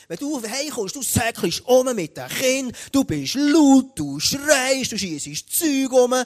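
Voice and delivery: high, loud mimicked scolding, building in force; loud retold complaint. Wife complaining to husband about coming home, loud and high. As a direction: raise your voice, hammering out each accusation.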